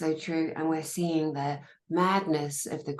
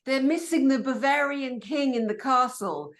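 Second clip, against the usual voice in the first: mock jeer; 8 semitones higher than usual. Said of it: "So I said the other day".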